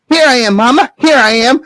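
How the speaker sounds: put-on high, eager boy voice; lightly voiced young Jack; little boy Jack. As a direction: answer Mama like a small boy. Child Jack answers.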